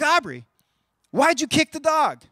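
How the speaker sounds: a hint of a stern parent asking; questioning parent. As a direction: call the child out.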